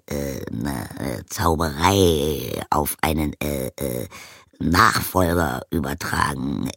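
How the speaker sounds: a plain craftsman groping for the right word; low voice full of ums; hesitant blacksmith. A narrator's reading.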